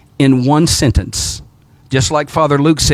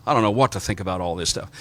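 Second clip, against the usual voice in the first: put-on dazed teen; 8 semitones lower, 9 dB quieter, faster than usual. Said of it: "just completely shell-shocked".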